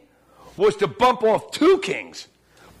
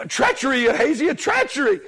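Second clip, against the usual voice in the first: higher-pitched, half-hearted yell; mild mock yell; 5 semitones higher than usual. Preacher voices fleeing king warning Ahaziah, mild mock yell. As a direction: imitate a warning cry without full volume. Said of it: "he's yelling to the other king".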